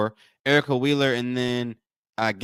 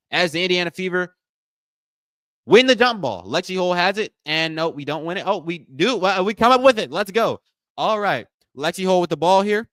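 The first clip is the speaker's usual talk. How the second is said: higher, excited commentary; live play-by-play; 6 semitones higher, 5 dB louder than usual. Real, not acted. Host calling the jump ball to viewers, excited play-by-play. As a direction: call the tip-off as it swings.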